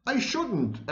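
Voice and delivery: high-pitched indignant protest; storyteller's offended outburst; indignant exclamation. A narrator's reading. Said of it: "Alice exclaimed indignantly".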